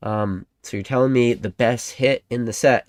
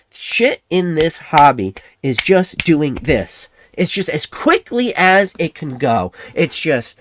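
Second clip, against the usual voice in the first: louder and slower, dragging the words out with excitement; hyped up; 6 semitones higher, 5 dB louder, slower than usual. Real, not acted. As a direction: sell the frenzy of prices shooting up. Hype about prices shooting up.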